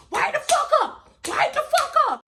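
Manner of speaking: shouting at the neighbors to wake up; yelling to wake neighbors. Retold or imitated. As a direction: bang on doors and yell. Banging on neighbors' doors.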